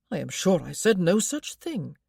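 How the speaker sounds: husband mildly indignant at her. A narrator's reading. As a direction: push back, a touch affronted. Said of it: "he told her indignantly".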